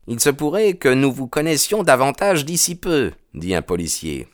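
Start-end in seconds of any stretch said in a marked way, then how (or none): 0.1-3.1 policeman's voice pitched much higher, a bit faster; smooth over the stranger's anger; placating policeman. Acted. Policeman calming the angry stranger, high voiced.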